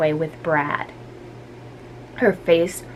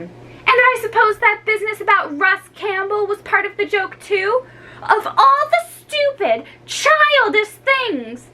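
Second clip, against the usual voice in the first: put-on much higher, scolding girl's voice; scornful girl; 13 semitones higher than usual. Jill scolds Ricky, high scornful girl voice. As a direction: tear into him with scorn.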